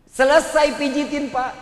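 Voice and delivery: usual speaking voice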